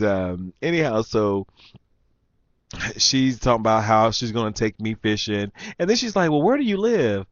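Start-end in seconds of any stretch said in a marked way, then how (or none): 6.3-7.2 high mock female voice; imitated prying woman. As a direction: mimic her nosy, flirty question.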